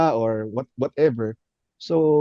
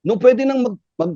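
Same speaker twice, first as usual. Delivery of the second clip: usual speaking voice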